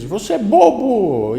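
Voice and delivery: high, loud, slow insult; angry shout. Acted. Angry boy insults the hidden voice, shouting. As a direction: yell it crossly like a riled boy. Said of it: "fica com raiva e diz".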